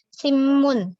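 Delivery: simun said as simmun; Korean n to m. Shown as a demonstration. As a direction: let the n of simun turn to m. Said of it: "dibaca seolah-olah sama-sama M". (simun dibaca seperti simmun)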